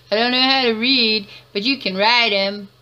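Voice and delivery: loud, high-pitched mock protest; mock outburst. Mocking line about word tattoos.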